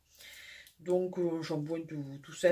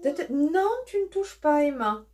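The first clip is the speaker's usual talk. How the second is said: sharp scolding; 7 semitones higher, 7 dB louder than usual. Real, not acted. Mother warning Emma off the precious item, loud and high. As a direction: stop her hand with a loud, high no.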